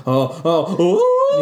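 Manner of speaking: high-pitched mock moans, building to a loud whoop; imitated sex moans. Imitating Samantha as the plane takes off, rising mock moans. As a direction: climb into a hammed-up ecstatic "ooh". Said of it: "at the same time of her going".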